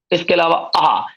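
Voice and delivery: ordinary talk, nothing marked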